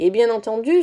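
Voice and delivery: ordinary delivery, nothing marked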